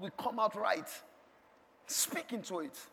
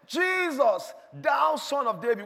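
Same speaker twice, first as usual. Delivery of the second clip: high cry on the name, easing after; howled cry; 5 semitones higher, 3 dB louder, slower than usual. Acted. Blind Bartimaeus calling to Jesus, howled shout. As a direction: call out desperately for Jesus. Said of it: "shouted, he howled".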